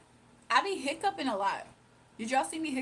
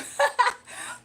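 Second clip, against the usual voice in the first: high breathy laugh; 10 dB louder than usual. Real, not acted. Laughing at Welsh joke.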